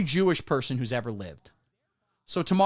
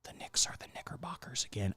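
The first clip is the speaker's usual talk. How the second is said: unvoiced whisper, much softer; 6 semitones lower, 12 dB quieter than usual. Shown as a demonstration. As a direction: whisper it so no one catches the word. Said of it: "I'm not gonna say that word again".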